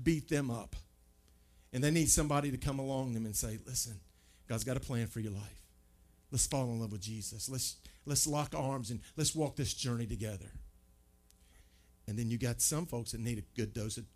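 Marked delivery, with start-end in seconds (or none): 3.7-10.5 softer, tender invitation; a friend gently offering grace; gentle encouragement. Acted. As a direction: coax them in kindly. Offering grace to a hurting person.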